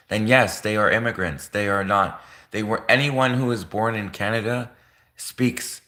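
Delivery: normal talking voice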